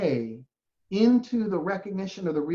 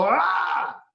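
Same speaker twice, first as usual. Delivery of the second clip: frustrated outburst; 8 semitones higher than usual. Pretend. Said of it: "you go".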